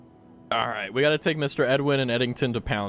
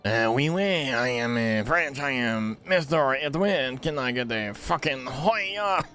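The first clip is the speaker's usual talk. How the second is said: slow, hammed-up French accent; mock French accent, dragging each word; slower than usual. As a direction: ham up a bad French accent. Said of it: "in a French accent say fucking?".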